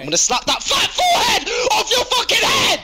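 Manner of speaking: high, drawn-out scream; over-the-top screamed threat; yelled threat. Real, not acted. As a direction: bellow it slowly, stretching the insults. Threatening a friend in heated argument.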